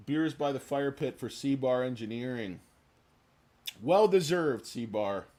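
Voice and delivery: ordinary talk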